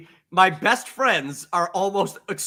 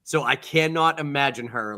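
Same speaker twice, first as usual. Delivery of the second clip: normal talking voice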